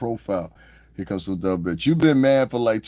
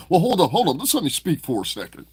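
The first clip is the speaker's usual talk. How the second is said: higher put-on voice, politely interrupting; imitating polite pleading; 7 semitones higher, 3 dB louder than usual. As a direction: pitch it up and beg to reason.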